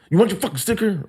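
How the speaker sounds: high, teasing questions; mocking taunt. Teller taunting the tow guy with the sticker, high mocking.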